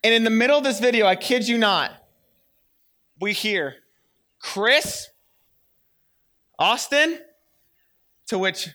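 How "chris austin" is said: dad calling out. Retold or imitated. Dad calling the kids from downstairs.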